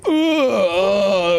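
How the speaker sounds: a high, strained "ugh" groan; mock disgusted groan. Showing how he'd audition, high disgusted groan. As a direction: act out a pained audition groan. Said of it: "here's me going".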